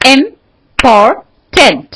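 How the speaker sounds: इम्पोर्टेंट in three slow, punched beats; split into syllables. Shown as a demonstration. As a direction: break इम्पोर्टेंट as इम, पोर, tent with pauses. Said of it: "फिर से देखो इंपॉर्टेंट को". (इम्पोर्टेंट को तीन हिस्सों में, रुक-रुककर)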